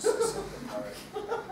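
high laughing squeak through the words. Real, not acted. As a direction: crack up mid-tag. Narrator laughs at own slip.

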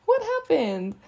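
high squeal of a laugh then the question. Acted. Teasing her pouty friend, laughing. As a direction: giggle through it, teasing.

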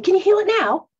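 high, loud calling voice; soul's call. Retold or imitated. The soul urging the hurt person to heal. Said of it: "your soul saying".

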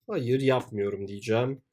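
normal talking voice

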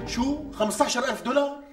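floored by the sum; shocked disbelief. Acted. Groom stunned by the fifteen-thousand-dollar cost, shocked outcry. Hearing the price.

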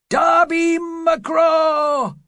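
eerie slow high shriek; ghostly scream. Acted. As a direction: wail the name like a ghost calling. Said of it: "it screamed".

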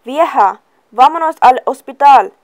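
loud, high urgent yell. A narrator's reading. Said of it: "and yelled".

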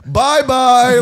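high, loud mock farewell; gleeful shouted taunt; jeering send-off. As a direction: imitate a heckler calling goodbye. Imitates old man jeering the ejected.

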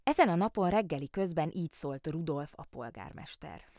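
ordinary delivery, nothing marked

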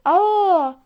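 loud sudden surprised exclaim. Real, not acted. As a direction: burst out in surprise. Surprise at a game event. (şaşkınlıkla bağırarak)

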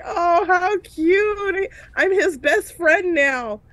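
high, drawn-out gushing coo; funny happy tears. Retold or imitated. Teacher touched by a little one's hug, mock-tearful gushing. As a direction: imitate tearfully melting over something cute. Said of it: "like the really funny tears".

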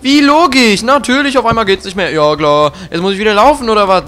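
loud, high sarcastic rant; mock the game in loud fury; sarcastic angry outburst. Real, not acted. (laut sarkastisch schimpfen)

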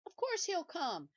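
overcompensate, shouting down the fear; too loud. Acted. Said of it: "he said too loudly".

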